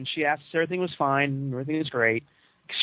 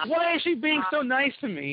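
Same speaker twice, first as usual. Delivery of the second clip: laughing, much higher, half suspicious; chuckling disbelief at her kindness; 9 semitones higher than usual. Retold or imitated. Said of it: "So suspicious".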